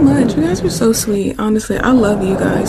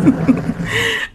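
high, amused giggle; light high-pitched laugh; 12 semitones higher, 4 dB louder than usual. Real, not acted. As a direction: giggle at a funny request. Giggles at a viewer's request.

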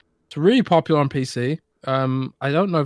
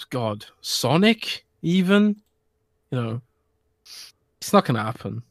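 ordinary talk

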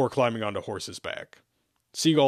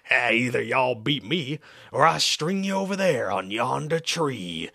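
slow, stretched hillbilly threat; hillbilly drawl; 3 semitones higher than usual. A narrator's reading. Banjo man threatens travelers with hanging, hillbilly drawl. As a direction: draw the words out, hammed-up hillbilly. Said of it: "The hillbilly looked in awe".

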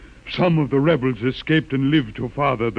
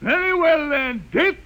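much higher, shrieking fury; furious shout; 13 semitones higher than usual. Acted. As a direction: scream for the heretic's death. Witness orders death of heretic.

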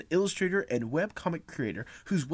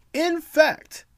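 excited; 8 dB louder than usual. Real, not acted. Sudden high excited interjection.

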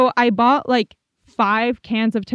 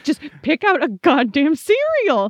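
mom's impatient outburst; 5 semitones higher, 4 dB louder than usual. Retold or imitated. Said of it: "She was like".

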